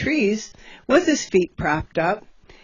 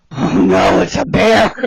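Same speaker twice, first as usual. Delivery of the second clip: alarmed; 4 semitones lower, 7 dB louder than usual. Retold or imitated. Spotting loose bear, alarmed.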